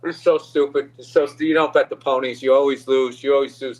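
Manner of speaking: a hint of a gruff father scolding; mock scold of a losing bettor; lightly voiced scolding dad. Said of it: "he's walking around going".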